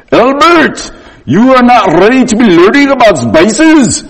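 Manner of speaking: high, loud mock Indian cook voice; hammed-up mentor scolding; Raj's voice. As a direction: imitate the frail teacher's big-eyed reply. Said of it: "I can hear his voice so clear".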